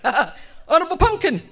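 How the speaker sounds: high, loud grand address; self-satisfied calling. Retold or imitated. Husband feeling proud hails wife, grand call.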